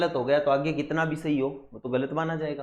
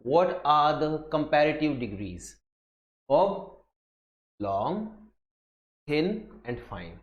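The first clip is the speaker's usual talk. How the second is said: reading the question aloud; much slower than usual. Shown as a demonstration. Teacher reads question off screen.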